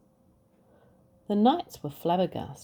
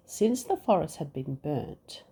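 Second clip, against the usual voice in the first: plain reading voice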